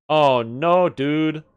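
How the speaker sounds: loud, high "oh no, dude" of real dismay; dismayed surprise. Real, not acted. Streamer spotting a bad gear requirement, loud dismayed surprise. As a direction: blurt it out, caught off guard.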